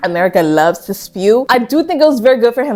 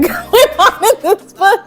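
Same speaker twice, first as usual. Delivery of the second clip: laughing through words; 11 semitones higher, slower than usual. Real, not acted. Reviewer mocking the book's propaganda, laughing as she talks. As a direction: voice jumps high as laughter takes over.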